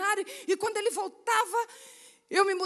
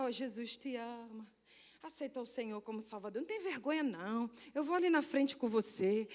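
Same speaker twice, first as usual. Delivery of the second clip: soft hushed prayer; 6 semitones lower, 8 dB quieter than usual. Retold or imitated. Believer prays into new convert's ear.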